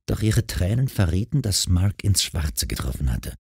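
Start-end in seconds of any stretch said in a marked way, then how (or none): none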